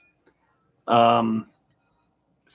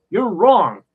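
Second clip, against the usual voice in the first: blunt mock correction; 7 semitones higher, 7 dB louder than usual. Quoting interviewee's blunt correction.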